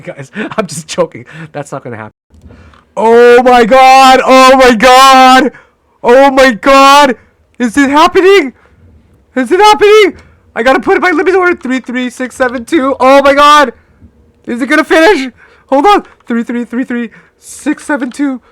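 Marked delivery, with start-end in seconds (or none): 3.0-7.2 slow, drawn-out yells, much higher and louder; real thrill as the trade nears; shouting in disbelief at the chart; excited shouting. Real, not acted. Trade about to hit target. 7.6-18.4 high, loud, rushed panic; excited trader panicking over his limit order; frantic excitement. Real, not acted. Frantic while placing order.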